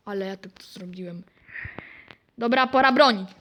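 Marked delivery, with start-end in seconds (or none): 0.1-1.2 hushed whisper. Real, not acted. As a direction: say it under your breath. Whispered aside during game.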